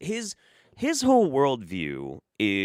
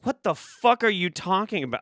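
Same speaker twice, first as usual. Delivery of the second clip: incredulous; 10 semitones higher, 6 dB louder than usual. Real, not acted. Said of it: "incredulous outburst".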